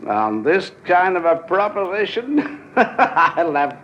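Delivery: chuckling through the words, slow and pleased; greedy chuckle while talking; gleeful laughter. Acted. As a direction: laugh as you count the money in your head. Gloating over a sure bet.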